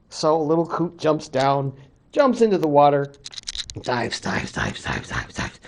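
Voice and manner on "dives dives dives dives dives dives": low, fading to a rhythmic whisper; hushed descent. A narrator's reading. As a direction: sink deeper with each repeated word. Coot sinking deeper and deeper.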